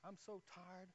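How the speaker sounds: soft, spent voice; worn out, trailing into softness; exhausted. Retold or imitated. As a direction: say it softly, drained from praying. Acting out exhaustion from praying.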